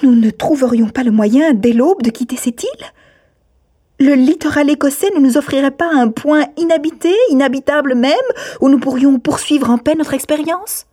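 high, forceful challenge allowing no evasion; insistent questioning. A narrator's reading. Miss Campbell challenges both uncles to find an island, insistently. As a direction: press them hard with indignant questions. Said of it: "posée d'un ton qui n'admettait ni échappatoire".

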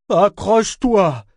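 high, loud, drawn-out whoop; jubilant shout. A narrator's reading. Ship warning his passenger as he floats, jubilant shout. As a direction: shout it out with glee. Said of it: "s'écria-t-il en jubilant".

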